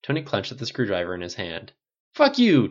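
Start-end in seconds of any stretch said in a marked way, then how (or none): 2.1-2.7 much higher, louder furious retort; shouted insult; angry snap. A narrator's reading. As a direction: bite back hard at a teasing friend. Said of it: "Tony snapped".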